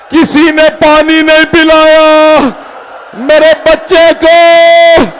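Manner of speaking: building from slow grief to a high wailing cry; climbing mourning recitation; mounting lament. Acted. Majlis lament rising to a cry.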